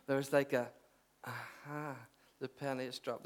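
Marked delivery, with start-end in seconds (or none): 1.2-3.2 very soft aha; quiet realisation. Retold or imitated. Preacher recalling the penny dropping, hushed. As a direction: say it under your voice, as if just understanding.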